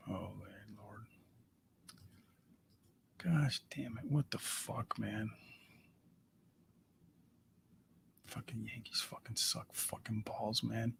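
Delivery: whispered cursing under breath; muttered whisper. Real, not acted. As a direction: swear in a hushed whisper. Cursing alone at the Yankees.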